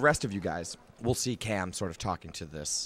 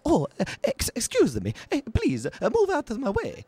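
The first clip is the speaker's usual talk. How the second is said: much higher, flustered, stammering voice; fussy little man; 14 semitones higher, 5 dB louder than usual. Acted. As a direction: squeak your way politely through a crowd. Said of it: "you hear a voice that says".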